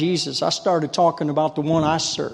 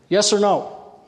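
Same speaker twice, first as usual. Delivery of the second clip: said differently; 5 semitones higher, slower than usual. Shown as a demonstration. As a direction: lift it and pull them in. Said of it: "I'll say it a little bit differently".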